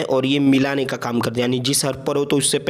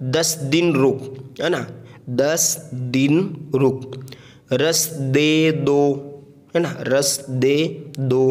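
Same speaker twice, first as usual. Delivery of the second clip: words stretched very slowly; slow classroom reading; slow model reading; much slower than usual. Shown as a demonstration. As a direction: read the words slowly for beginners. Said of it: "रवा से हमें इसको पढ़ना है".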